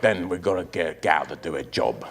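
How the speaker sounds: put-on low, quiet London gangster accent; thick, mumbled Cockney accent. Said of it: "impression of an accent".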